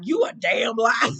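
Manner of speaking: slow, loud shout; retold shout. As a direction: shout it back, slowly. Mel shouting at ex.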